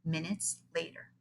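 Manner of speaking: ordinary delivery, nothing marked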